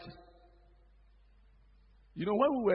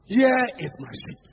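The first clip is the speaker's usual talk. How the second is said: mock shout; 4 semitones higher, 6 dB louder than usual. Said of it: "shouted the man".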